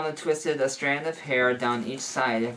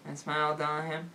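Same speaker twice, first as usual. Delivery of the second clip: ordinary delivery, nothing marked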